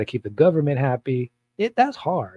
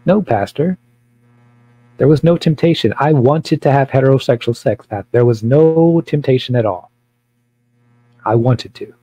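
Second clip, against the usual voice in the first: defiant; 7 dB louder than usual. Pretend. Arguing with an imagined pastor.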